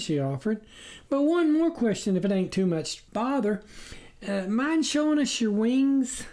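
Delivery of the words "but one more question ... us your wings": high, polite country wife asking; a farm wife with one more question; farmer's wife, curious. A narrator's reading.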